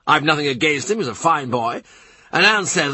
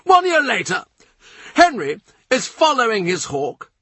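much higher, pressing, urgent storytelling; furious and earnest; 12 semitones higher than usual. A narrator's reading. Norfolk pressing his tale on the courtiers, furious and high. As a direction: keep pushing on in agitation. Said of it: "Wincing but furious, earnest, he presses on".

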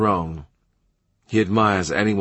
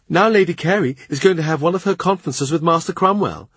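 higher, bright, helpful telling; a light air of cheerful information; agreeable informing tone; 8 semitones higher than usual. A narrator's reading. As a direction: pass on the news pleasantly. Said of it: "in a tone of giving agreeable information".

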